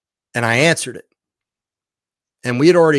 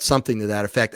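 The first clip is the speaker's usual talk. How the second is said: ordinary talk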